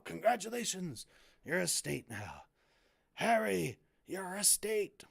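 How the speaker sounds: rough attempt at a gentle giant's voice; Hagrid. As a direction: congratulate like a kindly storybook gamekeeper. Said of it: "start trying to do a Hagrid, uh, impression".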